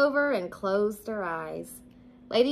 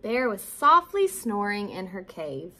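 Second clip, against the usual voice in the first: usual speaking voice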